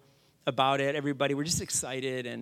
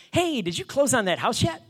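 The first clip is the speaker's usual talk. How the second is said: high, cheery caller impression; Master Ian; 9 semitones higher, 4 dB louder than usual. Karate master's son checks on the deal, cheery. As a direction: ask it in a chirpy, lifted voice. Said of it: "He's, like, really cheery guy".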